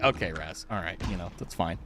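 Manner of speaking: low, quiet, placating mumble; resigned. Retold or imitated. Voices friends' resigned reply.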